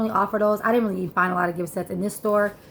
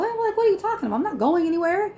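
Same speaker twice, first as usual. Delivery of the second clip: much higher, disbelieving protest; incredulous; 9 semitones higher, faster than usual. Retold or imitated. To the manager threatening to kick her out, high incredulous protest. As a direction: rise up in shocked disbelief.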